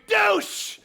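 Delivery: high, loud yelled insult; outraged shout. Acted. Heckling Geraldo from green room.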